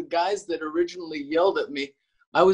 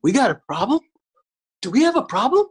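challenging strangers, pitch swooping up at the end; retold "you got a problem?" challenge; confronting challenge; 6 semitones higher, 5 dB louder, faster than usual. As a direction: imitate someone looking for trouble, sharp rising questions. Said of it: "they were like".

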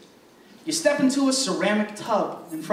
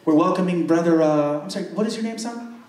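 a light, lower mock pastor voice, welcoming everyone; pastor addressing the congregation; 7 semitones lower than usual. Pastor welcoming the congregation to a baptism, in a lightly put-on formal voice.

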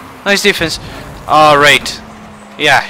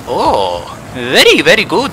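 normal talking voice

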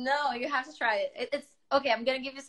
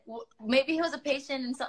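normal talking voice

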